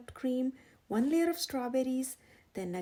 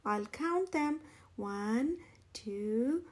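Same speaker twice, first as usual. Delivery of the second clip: plain reading voice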